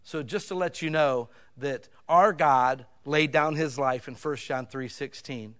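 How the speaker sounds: ordinary talk, nothing marked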